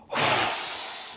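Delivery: soft breathy blow out; a long dragon breath; fire breath blow. Shown as a demonstration. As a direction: blow like you're putting out a fire. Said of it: "Breathe in".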